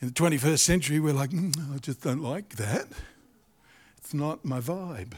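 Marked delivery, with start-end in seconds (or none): 1.3-5.1 soft, slow, dismissive shrug; mock dismissive. Mocking a modern churchgoer, soft and dismissive. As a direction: drawl it like you can't be bothered.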